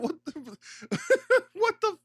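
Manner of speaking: very high, laughing splutter; laughing at the absurdity; disbelieving laugh. Real, not acted. Reacting to the Virtual Boy news, laughing high.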